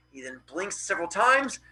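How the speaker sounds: plain reading voice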